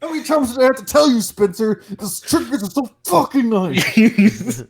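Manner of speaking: high-pitched mock-angry dad scolding; hammed-up parental yelling, last words dragged out. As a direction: scold like an exasperated, shouting father. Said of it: "You sound like my dad".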